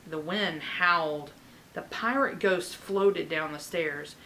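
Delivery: ordinary delivery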